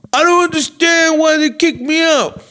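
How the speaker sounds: high, strained mock bar-guy voice; imitating the Italian guy. Retelling the loud Italian guy.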